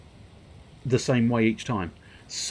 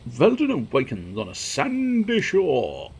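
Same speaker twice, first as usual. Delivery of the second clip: slow, louder narrator read; storybook narration; 6 semitones higher, 6 dB louder, slower than usual. Acted. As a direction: announce it like a storyteller. Reads game narration.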